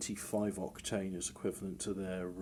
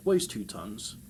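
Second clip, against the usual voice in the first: ordinary delivery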